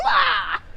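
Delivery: a sudden high wail bursting out of held-in tears; sudden cry. Retold or imitated. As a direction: let one loud sob-cry break free. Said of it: "before I bust out crying".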